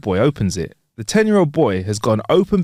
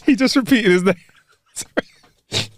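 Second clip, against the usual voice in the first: laughing; 9 semitones higher than usual. Real, not acted. Laughing at own joke.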